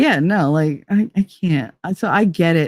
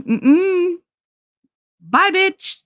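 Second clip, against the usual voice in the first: high, mocking send-off; higher scornful goodbye to the departing ex; sassy dismissal; 10 semitones higher, much slower than usual. Real, not acted. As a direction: wave him off with sass. Dismissing him as he leaves.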